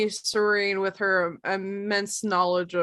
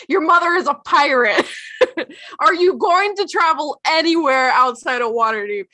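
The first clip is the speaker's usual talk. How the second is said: louder, higher nagging mom; exasperated mom; 6 semitones higher, 5 dB louder than usual. Pretend. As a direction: nag like a fed-up mother. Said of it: "And Serene's like".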